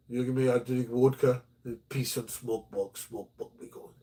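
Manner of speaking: mock Russian accent trailing off to a whisper; broken English sliding into a whisper; Vlad's Russian accent fading. Vlad repeating his vodka offer, mock Russian accent fading to a whisper. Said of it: "we had a Russian guy next door".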